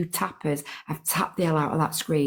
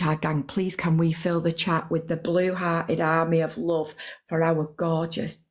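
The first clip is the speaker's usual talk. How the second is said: usual speaking voice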